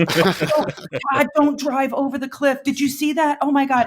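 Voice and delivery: high-pitched pretend panic; mock scream from the back. Bandmate scaring the driver on a cliff road, mock scream. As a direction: imitate someone crying out in alarm, only half shouted. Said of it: "she was just screaming it in the back".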